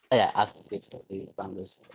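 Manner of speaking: broken mic cutting out; distorted, clipping microphone. Real, not acted. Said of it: "your mic is fried".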